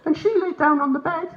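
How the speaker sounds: very high, squeaky cat voice; talking cat. Acted. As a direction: speak as a tattling cat, way up high. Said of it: "What if the cat is actually saying to me".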